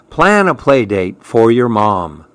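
plain reading voice

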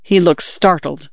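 usual speaking voice

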